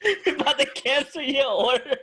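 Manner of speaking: high laughing echo of the line; laughing mimicry. Co-host repeating the restaurant guy's line, laughing high. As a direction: repeat it while cracking up. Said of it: "exactly how he said it".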